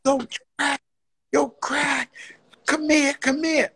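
high-pitched, urgent calling out; worked-up, high beckoning call; excited friend. Retold or imitated. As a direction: imitate a friend bursting with excitement. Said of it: "runs up to me with so much excitement".

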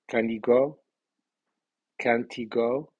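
"he" unstressed, linked onto "Can" and "Can't"; questions with a reduced "he"; weak contracted pronoun. Shown as a demonstration. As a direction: run "Can he" and "Can't he" together, rise on "go". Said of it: "el pronombre lleva acento débil".

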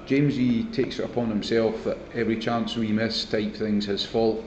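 normal talking voice

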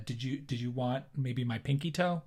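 ordinary delivery

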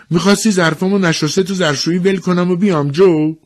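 high, loud joking retort; bantering village woman. Acted. Woman to the official about her dishes, bantering. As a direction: toss back a lively comeback.

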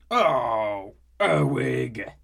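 loud, pained cry at the spill; annoyed outburst. Acted. Skivrin crying out when Herwig spills his ale, loud. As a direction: yelp as ale soaks you.

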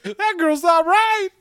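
slow, high-pitched pretend alarm; mock onlooker. Onlooker judging the possessed girl, high mock alarm.

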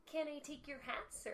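lightly high polite cashier; a hint of fake sweetness; customer service voice. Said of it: "You sound like SpongeBob".

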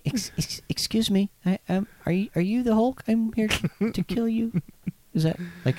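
high, timid, hesitant villain; nervous, meek supervillain. Acted. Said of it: "Real, like, timid".

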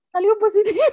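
high, loud blurt of shock; sudden high shocked cry; shocked announcement. Retold or imitated. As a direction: blurt it out, still stunned. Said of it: "que le digo".

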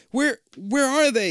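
frightened high stammer. A narrator's reading. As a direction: trip over the first word in fear. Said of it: "you stammer".

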